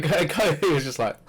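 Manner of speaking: ordinary delivery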